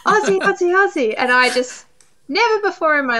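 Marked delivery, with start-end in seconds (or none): 0.1-1.1 high rallying chant; Hugh Jackman. Retold or imitated. Star calling to an Australian fan, rallying chant. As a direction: call out the chant to rouse a crowd. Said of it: "And then he goes".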